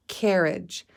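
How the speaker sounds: ordinary delivery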